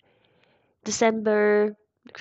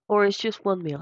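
normal talking voice

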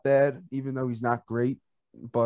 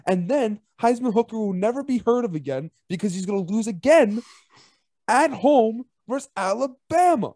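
excited; 6 semitones higher than usual. Acted. Mock-hyped prediction rant.

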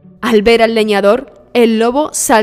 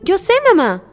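put-on high-pitched child answering mother; reply as an obedient little girl; high, childlike voice; 11 semitones higher than usual. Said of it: "respondió Caperucita Roja".

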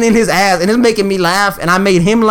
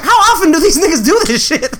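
laughing disbelief; 8 semitones higher than usual. Retold or imitated. Inner thought while laughing.

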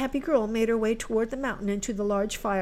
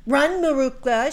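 higher, loud imperious order; bossy command; 6 semitones higher, 7 dB louder than usual. Acted. Helen sends Marouckla for apples, bossy command. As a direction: order her off like a servant. Stepsister gives a sharp order.

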